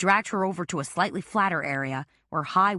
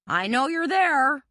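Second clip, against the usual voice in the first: taunting; 9 semitones higher, 4 dB louder, slower than usual. A narrator's reading. Villain taunting hidden heroine.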